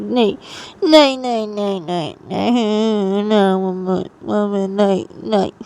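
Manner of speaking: put-on wailing, repeated child's plea; cling and sob out each no; crying refusal. Daughter begs mother not to leave, crying whine.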